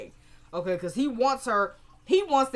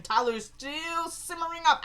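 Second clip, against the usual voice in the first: ordinary delivery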